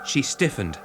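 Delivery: ordinary delivery, nothing marked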